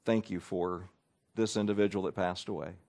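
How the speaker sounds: low, soft, hushed prayer; a whispered-close prayer for the family; quiet prayer. Shown as a demonstration. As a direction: pray gently into their ear. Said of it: "the prayer has been very simple".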